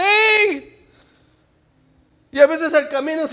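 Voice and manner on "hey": higher calling hey; God calling the worried man. Acted. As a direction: call out to get attention. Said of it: "Y Dios dice".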